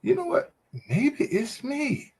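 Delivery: a woman realizing it might be her; dawning self-doubt. Retold or imitated. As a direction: let it dawn on you slowly. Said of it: "you have to think and say".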